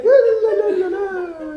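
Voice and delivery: very high, loud singsong la-la parody; carefree Italian. Retold or imitated. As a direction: trill away like a carefree Italian, hammed up. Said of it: "And Italians are like".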